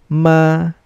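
"ma" high, level and held; first tone. Shown as a demonstration. Teacher reviews the four tones, "ma" in high level first tone. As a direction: sustain "ma" on one high pitch without moving. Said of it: "First tone".

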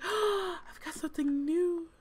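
high, excited gasp of delight; a pet thrilled by a new toy; excited cat. Pretend. Cat thrilled by her new toy, squealing high and excited. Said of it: "She's excited".

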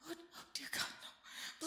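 breathless, whispered horror; terrified whisper. Acted. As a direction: gasp it out without voice. Terrified gasping plea.

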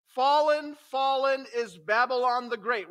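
loud, high cry of doom; proclaiming. A narrator's reading. Second angel announcing Babylon's fall, a loud high cry.

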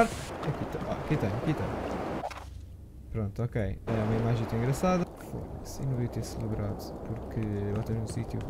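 low, soft voice; hushed unease. Real, not acted. Player in a darker place, regretting the celebration, hushed. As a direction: drop right down, wary and subdued.

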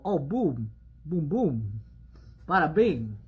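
a light mock East Coast accent. Sweet-talking boyfriend Adam, light East Coast accent. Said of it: "Adam has an East Coast accent".

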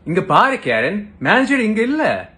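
loud, lively Tamil delivery; speaking Tamil. Acted. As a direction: switch into Tamil with bouncing high pitch. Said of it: "Oh, that was Tamil".

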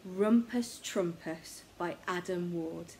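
usual speaking voice

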